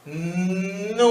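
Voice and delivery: put-on "Nudo" drawn out slowly for a child. Shown as a demonstration. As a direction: stretch "Nudo" gently, sound by sound. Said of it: "lo decimos despacito". ("Nudo" dicho despacito)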